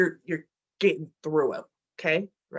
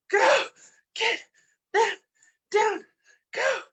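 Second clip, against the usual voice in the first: coach pushing hard; 8 semitones higher than usual. Real, not acted. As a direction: drive them through the last seconds. Coach urging final seconds.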